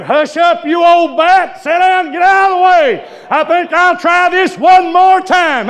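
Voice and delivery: shouted rebuke. Retold or imitated. Preacher retelling telling an old woman off, shouting. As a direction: bark it out like a fed-up old preacher, slowing at the end.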